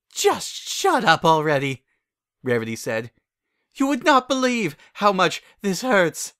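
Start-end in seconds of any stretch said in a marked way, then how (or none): 0.1-1.8 annoyed snap. Acted. Rarity snaps at Applejack. 3.7-6.3 pained complaint. Acted. Rarity in pain.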